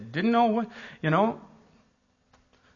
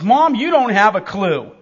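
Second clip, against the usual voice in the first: son scolding mom; 7 semitones higher, 6 dB louder than usual. Retold or imitated.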